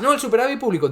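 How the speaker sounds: mock the economist's pat reply; mocking Rayo. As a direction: toss off his answer as if it were obvious.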